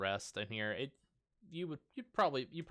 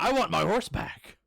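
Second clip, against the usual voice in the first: higher, louder complaint; indignant demand; 11 semitones higher, 9 dB louder than usual. Acted. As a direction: insist on it like a cheated shopkeeper. Shopkeep demanding his horse.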